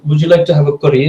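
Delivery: imitated Indian accent, sliding out of Irish. Shown as a demonstration. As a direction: put on a light Indian accent. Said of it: "an Indian person".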